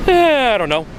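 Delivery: high, falling mock shrug of a voice; clueless salesperson. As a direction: imitate someone with no idea. Acts out clueless salesperson's answer.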